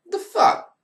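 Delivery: jumps way up high in disbelief; a shrill, outraged "the fuck?" pitched far above normal; incredulous squeak. Real, not acted. Reacting to Stephanie's promo line.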